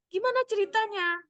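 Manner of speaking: lightly voiced mother; interested mother. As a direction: egg the child on. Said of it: "mother answering child".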